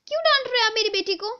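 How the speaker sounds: usual speaking voice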